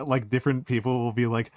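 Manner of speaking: ordinary talk, nothing marked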